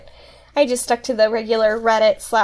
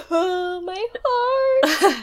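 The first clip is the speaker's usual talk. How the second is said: teary; 6 semitones higher, much slower than usual. Real, not acted. Moved by the story.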